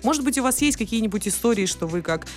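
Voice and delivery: usual speaking voice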